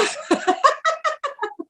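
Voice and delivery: high, soft run of giggles; laughing. Real, not acted. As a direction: giggle at your own story. Laughs at her own retold prayer. (tertawa kecil bernada tinggi)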